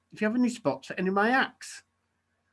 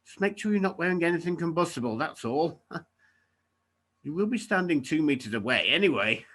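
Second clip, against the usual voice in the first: normal talking voice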